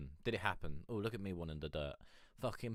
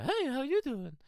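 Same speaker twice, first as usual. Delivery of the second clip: much higher, light pre-break voice; high boyish voice; 5 dB louder than usual. Retold or imitated. Telling a teen how he used to sound, high-pitched. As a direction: squeak it up like a kid before puberty. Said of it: "So rather than being like the".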